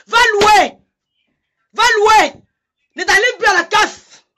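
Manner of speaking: loud, high, furious order to go rent; angry shout. Real, not acted. Ranting live at a rival, furious loud shout. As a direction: bark it out at her, full volume.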